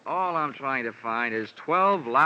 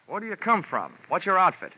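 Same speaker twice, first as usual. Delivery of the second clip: higher, sudden incredulous questions; startled demand; 6 semitones higher, 4 dB louder than usual. Acted. Investigator questioning an unexpected colleague, startled and higher. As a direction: fire questions at him in disbelief.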